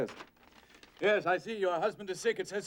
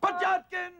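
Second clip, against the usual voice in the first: calling out; 12 semitones higher, 3 dB louder, slower than usual. Acted. Calling out for clerk.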